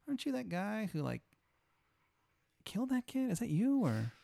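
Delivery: high-pitched tentative asking; tentative question. Acted. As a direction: ask it high, feeling your way. Festival act asks awkward question.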